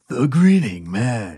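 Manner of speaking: slow, ominous title read; spooky announcer voice. Acted. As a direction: make the name sound scary. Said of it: "everything sounds scary when you're like".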